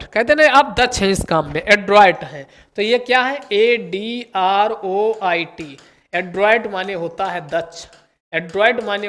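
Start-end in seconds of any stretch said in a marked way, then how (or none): 3.5-5.8 slow, letter-by-letter spelling. Shown as a demonstration. Teacher spelling adroit for students. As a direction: spell it out one letter at a time. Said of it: "spelling the word out".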